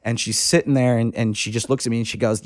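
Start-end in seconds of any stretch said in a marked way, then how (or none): none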